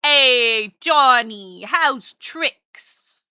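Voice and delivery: put-on loud old-time New Yorker accent; hammed-up old New York street accent. Shown as a demonstration. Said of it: "old New Yorker accent".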